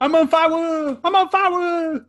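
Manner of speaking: high, loud laughing yell of panic; hammed-up burning man screaming for help; mock panicked scream. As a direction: shriek it like you're burning, laughing through it. Retelling him being set alight.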